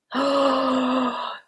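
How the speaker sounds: long, high gasp of amazement; delighted gasp. Real, not acted. Vlogger hearing of a wine-carrier handbag, delighted gasp. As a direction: gasp at a wonderful discovery.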